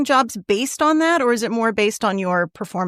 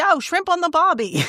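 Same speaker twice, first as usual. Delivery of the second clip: higher-pitched comic Aussie lead-in; jokey Australian accent, pitched up; exaggerated Australian accent; 8 semitones higher than usual. Shown as a demonstration. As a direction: do a bad, hammed-up Australian accent. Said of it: "an Australian accent".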